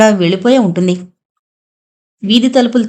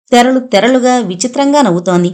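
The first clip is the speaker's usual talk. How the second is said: ordinary delivery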